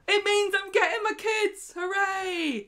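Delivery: high, loud mock cheer; acted hooray, voice leaping right up; retold jubilant reveal. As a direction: burst into gleeful triumph as if revealing good news. Theo's triumphant reveal after fake out.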